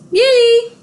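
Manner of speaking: high, loud squeal of delight; excited cheer. Real, not acted. Owner cheering her pets, squealing with joy. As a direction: cheer them on with a bright squeal.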